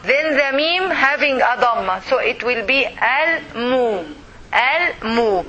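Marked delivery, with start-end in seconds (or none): 2.8-4.1 "al-mu" slowly, in two separate syllables; divided for teaching. Shown as a demonstration. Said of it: "We will divide it". 4.5-5.4 "al-mu" slowly, in two separate syllables; divided for teaching. Shown as a demonstration. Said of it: "We will divide it".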